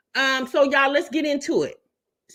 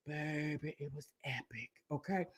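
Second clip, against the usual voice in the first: much softer, lower confiding murmur; hushed aside; 9 semitones lower, 19 dB quieter than usual. Real, not acted. Host confiding to viewers that the live was epic, hushed. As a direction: let it drop to a secret.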